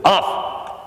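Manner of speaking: a sharp high barked "Off!"; sharp command. Shown as a demonstration. Trainer barks off at the puppy, sharp command. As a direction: snap the command at the dog. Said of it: "So we say".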